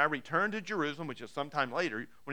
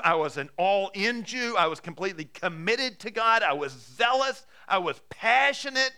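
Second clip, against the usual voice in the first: fervent declaration; 3 semitones higher, 7 dB louder than usual. Acted. Paul describes his zeal.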